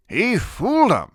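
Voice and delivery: loud, high-pitched accusation; angry arguing. A narrator's reading. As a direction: argue back hotly. Grandfather in a shouting argument.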